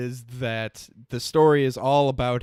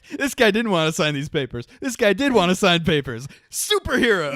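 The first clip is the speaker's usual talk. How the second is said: higher, louder mock excitement, jumping up on the last word; mocking imitation; 6 semitones higher, 5 dB louder than usual. Co-host teasing the other's superhero plot recaps, mocking. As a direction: send up an over-excited fan.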